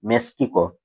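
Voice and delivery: মেক্সিকো said as meskiko, low and soft; মেক্সিকো as meskiko, quiet and low; mispronounced Mexico. Shown as a demonstration. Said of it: "মেক্সিকোকে আমরা কি বলে উচ্চারণ করি?".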